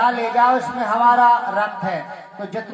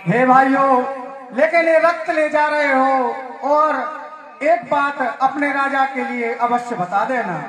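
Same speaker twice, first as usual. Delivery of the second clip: solemn sage calling to the envoys; sage giving warning. Acted. Sage sends word to the king through envoys, stern warning. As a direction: project a warning to the king's men.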